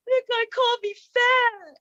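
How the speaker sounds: put-on high, drawn-out child's complaint; upset child. Upset son tattling to his mom, mock-whiny.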